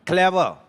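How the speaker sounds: "Clever" as one unbroken word. Shown as a demonstration. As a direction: say "clever" in a single push, no break inside. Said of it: "one syllable के शब्द हैं".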